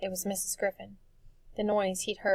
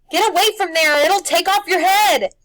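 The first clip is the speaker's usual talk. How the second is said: high, loud warning shout; frantic yell; 10 semitones higher, 16 dB louder than usual. A narrator's reading. Old woman warning the boy of the beast, frantic yell. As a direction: scream the danger at him. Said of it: "Missus Griffin yelled".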